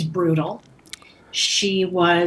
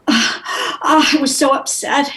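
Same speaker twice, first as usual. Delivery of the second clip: mock gasping upset, pitched up; 6 semitones higher, 4 dB louder, slower than usual. Student just slapped by her teacher, upset. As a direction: reenact being shaken and near tears.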